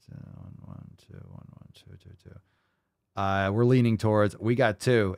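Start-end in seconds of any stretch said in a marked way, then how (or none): 0.1-2.4 whispered, counting to himself; barely audible tally under the breath; muttered counting. Real, not acted. Counting the viewer votes in a whisper.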